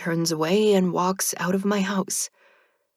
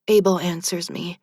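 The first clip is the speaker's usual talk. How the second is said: ordinary delivery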